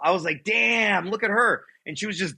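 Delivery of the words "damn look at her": high, drawn-out wow; amazed admiration. Retold or imitated. Son seeing his mother young and beautiful, retold amazement. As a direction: marvel in a raised pitch, retelling it.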